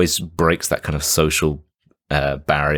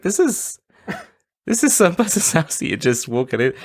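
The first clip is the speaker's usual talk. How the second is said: incredulous; 12 semitones higher than usual. Retold or imitated. Her own exasperated reaction while watching.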